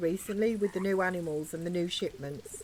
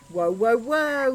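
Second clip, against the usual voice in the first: loud slow whoa; 3 semitones higher, 7 dB louder, slower than usual. Acted. Stopping someone in a game scene.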